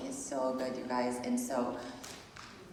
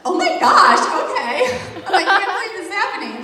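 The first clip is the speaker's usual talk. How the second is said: loud, high retold shock, breaking into laughter; laughing disbelief; 8 semitones higher, 12 dB louder, faster than usual. Told to start pushing, laughing disbelief. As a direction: relive the shock in a laughing, raised voice.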